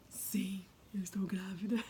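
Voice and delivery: whispered confession, hushed and tender; soft whisper. Retold or imitated. Telling her husband she is pregnant, hushed whisper. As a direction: breathe the news to him in a whisper.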